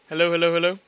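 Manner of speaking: ordinary talk, nothing marked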